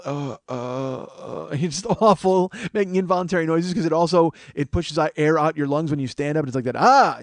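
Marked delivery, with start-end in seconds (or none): 0.0-1.5 strained, involuntary groans; weary string of achy grunts; old-man groan. Shown as a demonstration. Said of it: "it's just like this groaning". 6.7-7.2 loud strained "ah" on standing; sudden achy yelp as you stand; involuntary catch. Shown as a demonstration. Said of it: "like that weird j-, catch in your".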